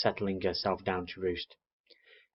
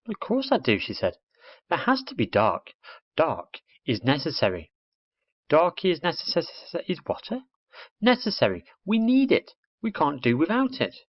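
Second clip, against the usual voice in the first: put-on higher girl's voice, firm and instructive; little girl explaining the dark, higher and firm; know-it-all little girl; 8 semitones higher than usual. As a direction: lecture him like a certain schoolgirl. Girl's voice in the story.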